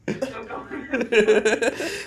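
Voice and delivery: loud, slow words through laughter; can barely get it out for laughing; retelling the shot while cracking up. Real, not acted.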